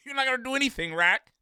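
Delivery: usual speaking voice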